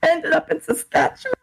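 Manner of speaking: high voice breaking up with laughter; helpless laughter. Real, not acted. Storyteller reaching her Saskatchewan punchline, laughing helplessly. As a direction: squeal the words out through real laughter.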